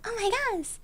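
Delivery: normal talking voice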